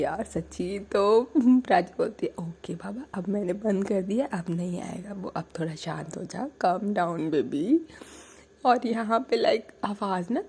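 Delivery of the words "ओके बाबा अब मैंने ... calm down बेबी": soft, hushed calming; calm her down gently; soothing. Retold or imitated. Soothing partner quietly.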